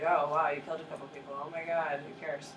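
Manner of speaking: mock indifference. Teacher voicing Europeans who don't care about the killings. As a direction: shrug it off sarcastically. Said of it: "It's like".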